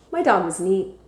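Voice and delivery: plain reading voice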